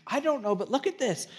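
very high, excited imitation of a stunned dad; hammed-up amazement, almost squeaking; amazed. Acting amazed dad showing tooth.

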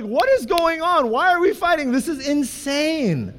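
very high-pitched retold bewilderment; incredulous, drawing out the last words; exasperated disbelief. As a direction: plead for sense amid the madness. His exasperated thought during the fight.

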